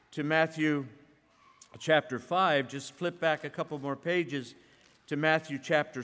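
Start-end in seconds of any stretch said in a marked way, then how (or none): none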